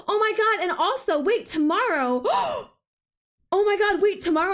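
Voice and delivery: high gasping oh my God; excited gasps. Real, not acted. Streamer realizing she can order cinnamon rolls, gasping. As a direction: gasp with sudden delight.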